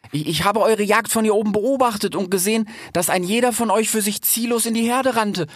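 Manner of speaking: high, earnest fox with stumbles; nervous fox. A narrator's reading. Fox lectures cheetahs from the tree, high nervous earnestness. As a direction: explain eagerly, voice pitched well up.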